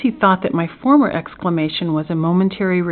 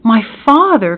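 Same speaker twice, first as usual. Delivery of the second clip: louder, higher astonished exclamation; joyful cry; 4 semitones higher, 6 dB louder than usual. A narrator's reading. Prisoner learns his father has come, astonished cry. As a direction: cry out as anguish turns to pleasure. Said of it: "cried I".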